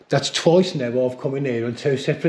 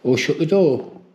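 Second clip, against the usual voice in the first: calling out; 5 semitones higher, 5 dB louder than usual. Real, not acted. Calling out to a spirit.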